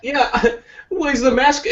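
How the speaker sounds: high, laughing mock question. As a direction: giggle through it. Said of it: "continues mask quote".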